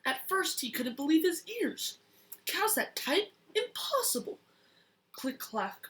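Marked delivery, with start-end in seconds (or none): 0.1-4.4 surprised. Acted. Child reads farmer's disbelief.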